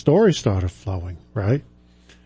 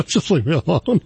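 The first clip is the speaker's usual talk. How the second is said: laughing; 10 semitones higher than usual. Real, not acted. Laughing through the punchline.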